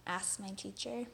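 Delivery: ordinary delivery, nothing marked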